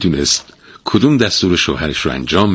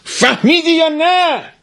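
very high, slow yell; a furious wife yelling at her husband; shouting; slower than usual. A narrator's reading. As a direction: scream it right in his face. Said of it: "بر سر مرد فریاد کشید".

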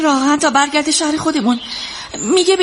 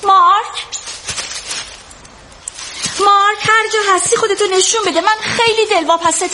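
raised, higher voice calling for a missing man; worried calling out; 5 semitones higher than usual. Acted. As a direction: call out anxiously, searching for him. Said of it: "داد نزن دخترم". (با نگرانی و صدای بلند صدا زدن)

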